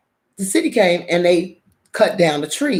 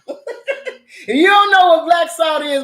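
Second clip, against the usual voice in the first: slow, high and laughing through the words; laughing aside; 10 semitones higher, 4 dB louder, slower than usual. Real, not acted. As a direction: giggle as you let them in on it.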